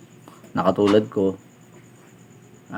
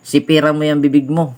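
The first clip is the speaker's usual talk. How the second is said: mock angry snap; 5 semitones higher than usual. Customer scolding driver, voiced.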